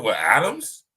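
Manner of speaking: rising, questioning answer; tentative guess. Retold or imitated. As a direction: offer it as an unsure question. Retells his tentative answer to God.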